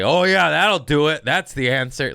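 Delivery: loud, high mock cheering; imitated sarcastic shout from the stands; sarcastic heckle. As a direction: yell it like a fed-up fan. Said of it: "sarcastic shit".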